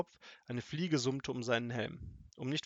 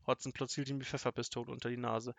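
usual speaking voice

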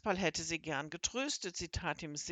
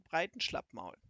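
ordinary delivery, nothing marked

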